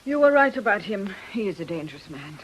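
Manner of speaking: normal talking voice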